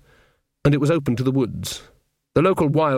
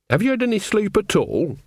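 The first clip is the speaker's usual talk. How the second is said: lightly voiced fussing old witch, higher pitch; concerned Nanny; 8 semitones higher than usual. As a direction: question a friend with rising concern. Nanny questions tired Granny.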